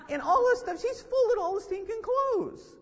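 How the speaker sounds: loud, high-pitched comic outburst; mock exasperation. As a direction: blurt it out in exaggerated dismay, stretching the end. Acts out his exasperated reaction upstairs.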